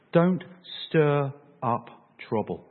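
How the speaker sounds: slow, spaced-out words; measured, emphatic caution; deliberate warning. Acted. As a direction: warn them with a pause after "don't". Paul's warning, voiced word by word.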